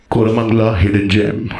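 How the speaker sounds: low, deep Instagram voiceover; mock the reel announcer. Mocking the reel playing on his wife's phone.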